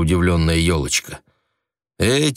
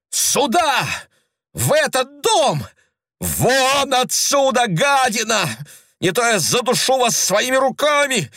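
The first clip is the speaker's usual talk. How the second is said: very high, shrieking fury; woman shrieking at the intruder in a frenzy; frenzied outburst; slower than usual. A narrator's reading. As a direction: scream him out of the house. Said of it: "на эти исступлённые возгласы".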